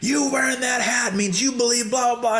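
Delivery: high mock shout, ranting; imitated screaming. Owner accusing the customer over the hat, high mock shout. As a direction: rant at him like the enraged owner. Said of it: "he's just screaming and yelling".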